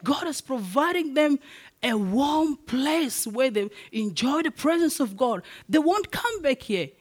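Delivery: fervent pulpit delivery; full-force preaching. Acted. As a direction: preach it to the grieving congregation.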